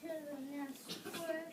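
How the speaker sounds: usual speaking voice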